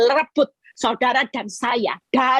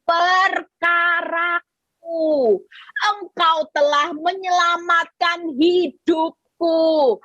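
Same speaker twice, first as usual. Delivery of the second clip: slow, higher prayer voice pleading for justice; 4 semitones higher, slower than usual. Acted. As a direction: pray it slowly, lifting up to God. Praying the verse aloud. (suara doa pelan memohon keadilan)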